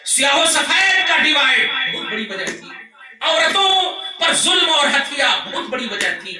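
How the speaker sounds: high, loud sermon with hammered key words; fiery preaching. Acted. Preacher listing the evils a prophet came to end, fiery preaching. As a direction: build a forceful list from the pulpit.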